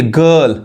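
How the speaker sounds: गर्ल as "guhl", no r; silent r. Shown as a demonstration. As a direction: drop the r in गर्ल. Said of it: "गर्ल नहीं".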